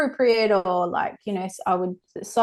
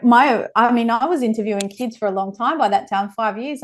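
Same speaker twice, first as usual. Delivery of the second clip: ordinary talk, nothing marked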